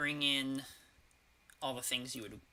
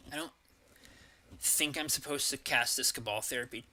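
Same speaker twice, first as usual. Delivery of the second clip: talking through hiccups; hiccuping between words; 2 semitones higher, 6 dB louder than usual. Real, not acted. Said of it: "got the hiccups".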